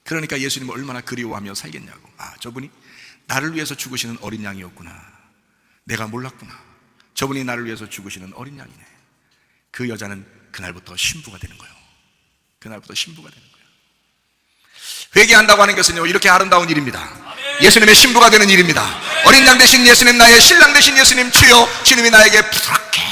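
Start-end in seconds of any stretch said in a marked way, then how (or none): 2.2-8.8 soft, low, moved murmur to oneself; quiet realization. Acted. Woman's moved, private realization voiced. 15.1-23.0 loud, high, declamatory proclamation; rousing sermon climax; full-force preaching. Acted.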